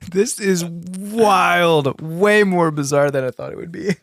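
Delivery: talking through real laughter; helpless laugh breaking into the words; amazed, laughing as you speak. Real, not acted. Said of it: "I'm over here laughing".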